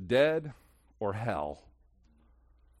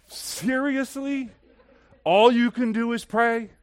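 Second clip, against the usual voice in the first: high, drawn-out "seriously", then loud disbelief; indignant, incredulous questioning; incredulous challenge; 11 semitones higher, 8 dB louder, slower than usual. Real, not acted. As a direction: throw it back in loud, high-pitched disbelief. Preacher challenges the remark he acted.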